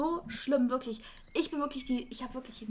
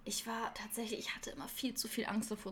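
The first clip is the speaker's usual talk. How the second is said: whispering; 2 semitones lower, 12 dB quieter than usual. Real, not acted. Confessing fear of cheating.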